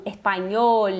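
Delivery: "espanhol" with closed O and clear final L; Spanish pronunciation. Shown as a demonstration. Teacher models the Spanish word, closed O and clear L. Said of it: "são mais fechados".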